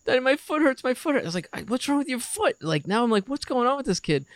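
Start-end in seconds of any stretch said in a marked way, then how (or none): 0.1-1.2 put-on high whining little-kid voice; mock toddler complaining daddy; whiny child. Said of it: "he goes". 1.5-4.2 alarmed. Retold or imitated. Dad alarmed by son.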